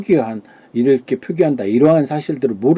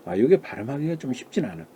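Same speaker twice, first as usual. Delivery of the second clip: ordinary talk, nothing marked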